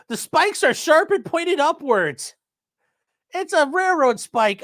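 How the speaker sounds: slow, high, overdone alarm; mock dramatic reading. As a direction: read it like a scare story. Mocking article claim, slow and high.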